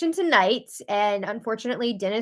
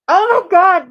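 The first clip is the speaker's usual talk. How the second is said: loud, high shocked outburst; stunned viewer reacting to a brutal line; shocked exclamation; 8 semitones higher, 10 dB louder than usual. Real, not acted. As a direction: gasp it out in disbelief. Reacting to "I hate you.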